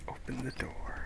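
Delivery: hushed whisper, barely voiced. Real, not acted. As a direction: whisper it under your breath. Streamer whispers to the game.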